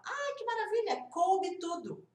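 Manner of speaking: put-on high, delighted gush to a child; admiração exagerada. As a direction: gush as a mum praising her little girl. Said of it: "eu falei pra ela". (encantada, com voz aguda)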